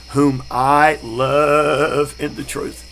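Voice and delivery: drawn-out, deliberate verse reading; slow scripture reading. Shown as a demonstration. Preacher to class, reading scripture slowly. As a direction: read the verse slowly and reverently.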